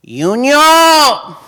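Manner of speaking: high long yell across a field; grandma calling out. Retold or imitated. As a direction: call it out like a grandma to a far-off field. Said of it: "he could still hear her scream out".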